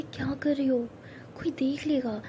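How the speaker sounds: hushed unvoiced whisper. Ishani whispering to Pari, afraid someone sees. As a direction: whisper so nobody overhears.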